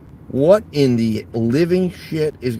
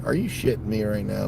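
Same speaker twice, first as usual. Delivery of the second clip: normal talking voice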